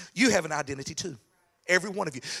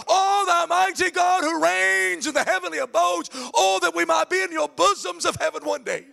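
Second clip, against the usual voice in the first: high, loud, slow mock-grand prayer; mock-lofty prayer voice, higher and slower; long-winded pompous prayer; 10 semitones higher, 4 dB louder than usual. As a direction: ham up the old man's flowery praying. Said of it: "He could go".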